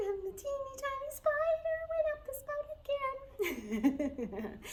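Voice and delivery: very high, tiny spider voice; teeny tiny voice. Shown as a demonstration. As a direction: sing like the tiniest little spider. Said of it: "with teeny tiny voices like this".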